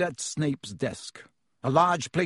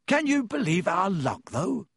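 talking thickly through food; a light touch of a mouthful; mouth full; 4 semitones higher than usual. A narrator's reading. Boy marvels at their luck over sandwiches, mouth full. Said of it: "said Ron thickly through a mouthful".

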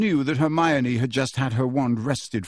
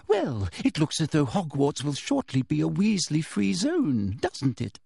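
Umbridge menace; 2 semitones higher than usual. Acted. Umbridge interrogating Harry, sugary menace. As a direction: press him sweetly.

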